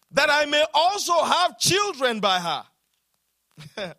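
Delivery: loud, high, drawn-out preaching read; ringing, slow pulpit reading; scripture proclamation. Acted. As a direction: proclaim the verse to the whole church.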